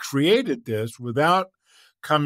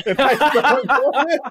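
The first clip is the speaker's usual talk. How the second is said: high, loud panicked storytelling, laughing; dad telling son he fled a bear, panicked and laughing; panicked yell; 6 dB louder than usual. Retold or imitated. Frantic shout with laughter.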